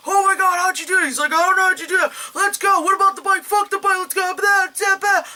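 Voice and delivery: put-on high-pitched panicked kid; frantic high voices. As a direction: rattle it off in a frenzy. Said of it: "they're like".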